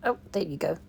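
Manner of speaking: normal talking voice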